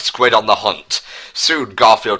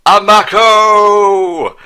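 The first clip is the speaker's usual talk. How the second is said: high, loud, long drawn-out call; 11 semitones higher, 7 dB louder, much slower than usual. Acted. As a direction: hail him from far across the hillside. Calling out from the hillside.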